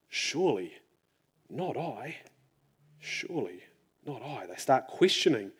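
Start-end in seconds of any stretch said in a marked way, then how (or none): 0.1-2.2 mild sorrowful murmur; saddened. A narrator's reading. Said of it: "They were saddened". 3.0-4.5 soft grieving near-whisper; hushed sad echo. A narrator's reading. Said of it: "They were saddened".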